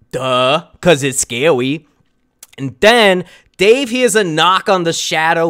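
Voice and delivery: ordinary delivery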